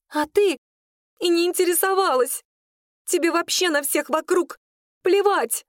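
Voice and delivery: put-on high, indignant girlish reproach; angry again. A narrator's reading. Claudine blames her rival for not caring, high and angry. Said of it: "Опять разозлилась Клодина".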